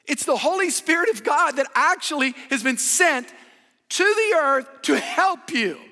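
loud, high, slow preaching climax; full-force preaching. Acted. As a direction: hammer it home, loud and drawn out.

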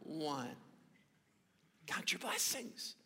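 whispered, near breathless; hushed. Acted. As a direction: breathe the words softly to the room. Soft repeat of hymn line.

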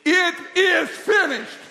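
slow, high, loud proclamation; emphatic declaration. Shown as a demonstration. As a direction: shout each word separately, stretched out. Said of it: "And what do you say?".

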